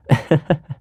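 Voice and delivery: short, light laugh; happy chuckle. Acted. Revealing a friend's birthday party, happy chuckle. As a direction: give a pleased little chuckle.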